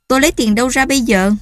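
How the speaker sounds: exasperated snap. Acted. Husband snaps back at wife.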